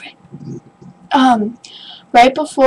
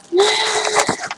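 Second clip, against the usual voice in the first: excited gasp; 10 semitones higher, 5 dB quieter than usual. Real, not acted. Opening a package.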